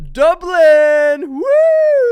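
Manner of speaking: very high, very loud shout of the city; shouted cheer. Real, not acted. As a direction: yell it to the crowd. Announcing first tour stop.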